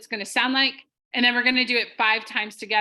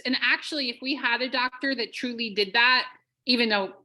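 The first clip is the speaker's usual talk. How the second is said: ordinary talk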